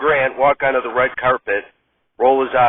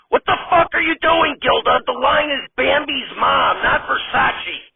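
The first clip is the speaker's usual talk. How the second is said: much higher, exasperated scolding, shouting NOT; angry director; 11 semitones higher than usual. Acted. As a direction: chew out the actress for going off script.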